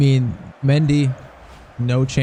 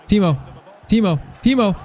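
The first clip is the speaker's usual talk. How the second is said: building excitement; 7 semitones higher than usual. Real, not acted. Calling out a player's run.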